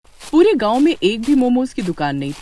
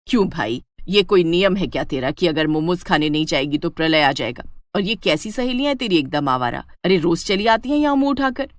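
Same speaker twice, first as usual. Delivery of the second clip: scolding mother-in-law; 2 semitones lower than usual. Acted. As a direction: tell off your daughter-in-law.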